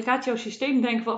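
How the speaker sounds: usual speaking voice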